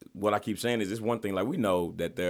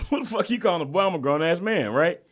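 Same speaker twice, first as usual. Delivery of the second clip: a higher, louder, indignant retelling of telling him off; retold angry retort; 8 semitones higher, 5 dB louder than usual. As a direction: replay the outrage at being called boy, pitched up. Retold confronting racist customer.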